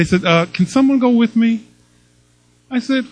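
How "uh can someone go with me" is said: put-on child's timid little plea, pitched up; 1 timid grandson. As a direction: play the scared grandson asking for help. Scared grandson asks for company.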